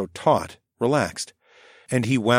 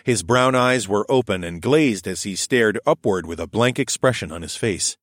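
ordinary delivery

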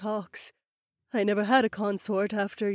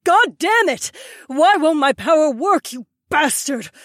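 much higher, louder raging noblewoman; furious curse; 6 dB louder than usual. Acted. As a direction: spit out the curse in rage. Power fails, she curses.

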